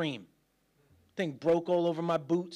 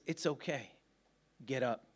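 soft, low, tender encouragement; gentle reassurance; 7 semitones lower, 8 dB quieter than usual. Acted. The Lord to us when we mess up, quietly reassuring. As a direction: reassure quietly, voice sinking with each phrase. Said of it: "what he says to us when we mess up".

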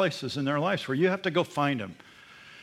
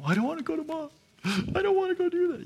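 put-on much higher whining kid refusal; whiny child; faster than usual. Father recalls his son refusing to apologize, high whiny kid voice. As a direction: whine it like a stubborn little boy.

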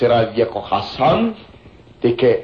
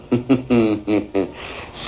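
sly villain chuckle; 2 semitones lower, 4 dB quieter than usual. Acted. Villain tempting two men with gold, sly chuckle. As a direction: chuckle like you already own them.

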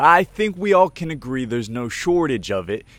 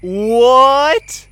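shocked what; 11 semitones higher, 9 dB louder than usual. Acted. Baffled at the friend's response.

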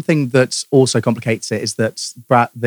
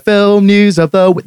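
announcer voice; 7 semitones higher, 4 dB louder, slower than usual. Pretend. Jingle intro repeated slower.

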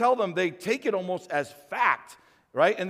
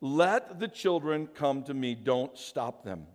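gentle Jesus; 6 semitones lower, 4 dB quieter, slower than usual. A narrator's reading. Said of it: "he said to the disciples".

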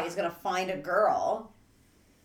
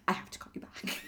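hushed, barely voiced, much softer; hushed quote; 12 dB quieter, faster than usual. Friend whispering she must hang up, hushed. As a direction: drop to a near-whisper to get off the phone. Friend ends the call abruptly.

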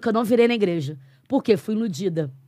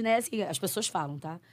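usual speaking voice

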